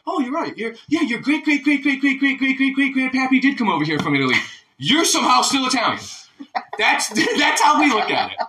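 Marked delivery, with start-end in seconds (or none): 0.1-6.0 high, overdone sing-song agreement, mocking itself; mocking gushing American. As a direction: gush it like a fawning American, high and mock-sweet. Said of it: "We're like".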